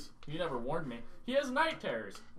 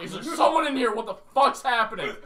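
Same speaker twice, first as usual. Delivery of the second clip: retold frightened yell; 5 semitones higher, 8 dB louder than usual. Searching the room, scared.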